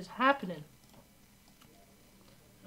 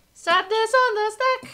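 much higher, louder sing-song Sadness on the stack; 12 semitones higher, 8 dB louder than usual. Real, not acted. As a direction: croon "Sadness on the stack" high and rising.

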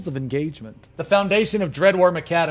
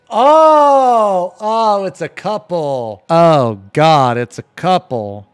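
excited outburst; 11 dB louder, slower than usual. Real, not acted. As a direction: shout it out in delight. Reacting to a couple photo.

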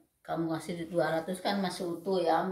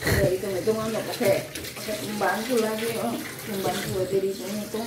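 louder, indignant complaint; real exasperated louder grumble; annoyed complaint; 7 dB louder than usual. Real, not acted. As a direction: vent about him counting every cost. Wife complains loudly.

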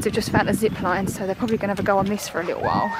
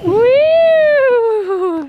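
cheering woo; 14 semitones higher, 7 dB louder than usual. Real, not acted. Cheering baby on swing.

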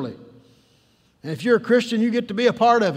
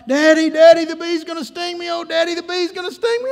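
high-pitched mock little girl in panic; panicked crying out; 8 semitones higher, 6 dB louder than usual. Little girl in back seat to her daddy, panicked. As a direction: cry out like a frightened child. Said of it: "begins to cry out".

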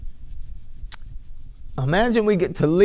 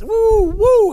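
ecstatic whoop; 6 dB louder than usual. Real, not acted. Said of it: "My goodness".

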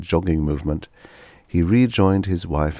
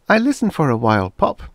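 put-on higher child's voice, quick; a small boy eagerly volunteering; little boy; 9 semitones higher, 4 dB louder than usual. A narrator's reading. Son telling his father what he heard, lightly voiced boy.

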